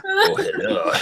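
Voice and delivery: squeaky high, laughing hello; mock cute hello; playful greeting. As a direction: greet them in a silly filter voice. Greets with filter face.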